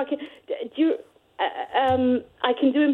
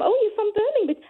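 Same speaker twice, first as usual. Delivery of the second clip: much higher, lightly voiced Brummie question; a hint of a Birmingham accent, pitched well up; lightly done Birmingham accent; 10 semitones higher than usual. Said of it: "I definitely had".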